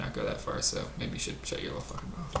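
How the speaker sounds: very quiet, low muttered aside; muttered put-down. Acted. Host telling guest to shut up, muttered low.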